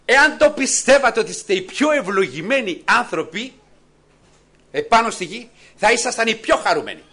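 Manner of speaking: high, loud exhortation; full-force preaching. Acted. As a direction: lift the whole congregation with it. (κήρυγμα με δύναμη, ψηλά και δυνατά)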